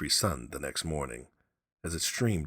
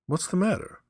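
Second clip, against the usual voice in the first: startled; 13 semitones higher, 5 dB louder than usual. Acted. Husband breaks off, alarmed.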